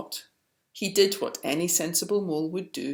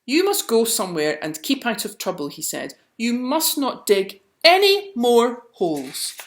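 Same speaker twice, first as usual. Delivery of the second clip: angry scolding; 6 semitones higher, 7 dB louder than usual. A narrator's reading. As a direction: scold the mole firmly. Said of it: "He was angry".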